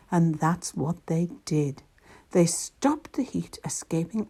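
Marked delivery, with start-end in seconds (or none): none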